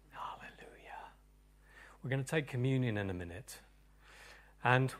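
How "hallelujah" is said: much softer, breathy praise; hushed hallelujah; whispered hallelujah. Real, not acted. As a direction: breathe it out reverently. Soft aside after psalm.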